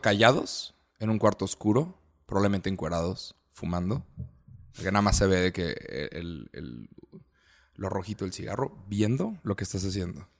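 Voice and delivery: low, soft, halting description; hushed scene-painting. Real, not acted. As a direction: let the voice sink low and quiet, with pauses. Imagina oyentes callados a oscuras. (en voz baja y grave, con pausas)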